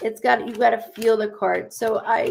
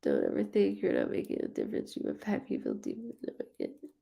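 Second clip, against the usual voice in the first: choked-up quiet; 10 dB quieter, faster than usual. Real, not acted. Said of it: "You make me cry".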